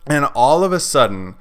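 ordinary delivery, nothing marked

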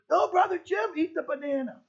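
put-on high, loud chorus of kids; children's voices. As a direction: sing it out like a room of children. Voicing the kids answering.

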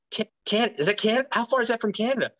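high-pitched, stunned retold disbelief; shocked disbelief. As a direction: squeak out the question in disbelief. Retold shock at the town name.

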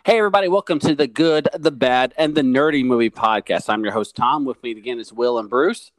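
booming, loud show announcer; big loud hype intro. Acted. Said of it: "when you go into announcer voice".

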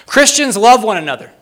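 high, loud declaration; proclaimed quote reading. Real, not acted. Preacher reads a historian's words about Christians, high and emphatic. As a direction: proclaim it to the whole congregation. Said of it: "read these slowly and succinctly".

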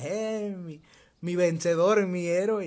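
high, slow, swooning admiration; loud, lovestruck girlish voice; girl praising her hero. Retold or imitated. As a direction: gush over him like a little girl in love. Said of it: "la morrita así como de".

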